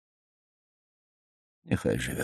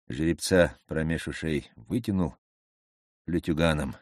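plain reading voice

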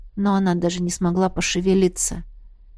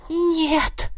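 hoarse higher rasped "no"; wheezing; 9 semitones higher than usual. A narrator's reading. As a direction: force out a croaking no. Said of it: "просипела Кармелла".

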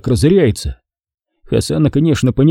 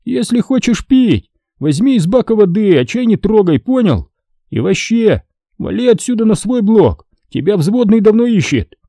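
a raised voice shooing away a freeloader; Hasan scolding; 10 semitones higher than usual. A narrator's reading. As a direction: order him off, annoyed. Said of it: "Hasan chasing Kachan off".

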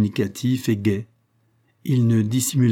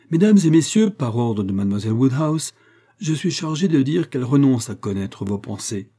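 louder announcement; 3 semitones higher than usual. Acted. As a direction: call the room to order, trying harder. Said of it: "Je vais m'y prendre mieux". (annonce plus forte et plus aiguë, comme un héraut)

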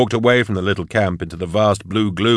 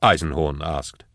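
ordinary delivery

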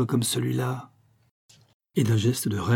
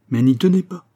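loud, higher angry retort; raised-voice comeback; crying out; 4 semitones higher, 6 dB louder than usual. A narrator's reading. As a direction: cry it out sharply. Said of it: "s'écria Nicole".

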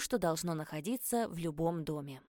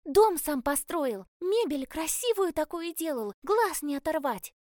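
proud boasting; 10 semitones higher, 6 dB louder than usual. A narrator's reading. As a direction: boast about her father with pride. Girl boasting about her father.

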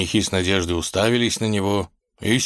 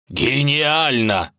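raised, drawn-out cry of approval; exclaiming; 7 semitones higher, 4 dB louder, much slower than usual. A narrator's reading. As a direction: exclaim slowly at a brilliant idea. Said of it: "воскликнул Волк".

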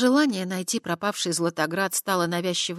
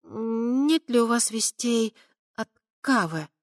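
hesitant; 6 semitones higher, slower than usual. A narrator's reading. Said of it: "слегка запнувшись".

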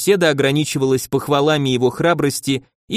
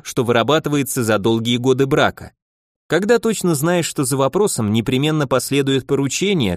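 ordinary talk

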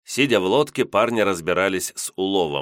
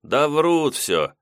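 amused scoff; 8 semitones higher, much slower than usual. Acted. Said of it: "Колбята Лосенков усмехнулся".

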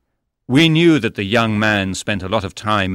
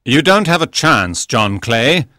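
firm, louder confrontation; stern challenge; 5 semitones higher, 5 dB louder than usual. A narrator's reading. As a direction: face down the robber. Holmes confronts the armed robber.

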